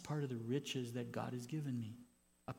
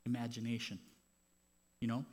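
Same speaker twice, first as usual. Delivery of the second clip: ordinary talk, nothing marked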